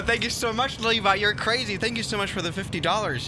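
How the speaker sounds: high, delighted gush of thanks; excited thanks. Real, not acted. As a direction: thank a donor in amazed delight. Thrilled by a big donation.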